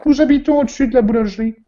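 high, loud falsetto widow; high woman's voice. Acted. Widow answering the inspector in a high, loud voice.

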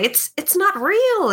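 high, urgent reassurance; high character voice. Acted. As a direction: call out to stop them. Rocco calls out to creatures.

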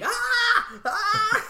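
high hammy scream; mock scream, loud and high. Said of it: "you end up screaming".

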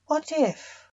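lightly voiced sceptic's question, pitched high; wondering doubter. A narrator's reading. Narrator voices wondering theorists.

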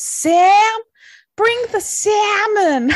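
loud, high-pitched film-quote impression; Jennifer Coolidge. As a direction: call it out slowly, high and hammed-up. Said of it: "Jennifer Coolidge goes".

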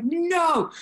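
a high, stubborn refusal; high firm no. Retold or imitated. Flat refusal, acted.

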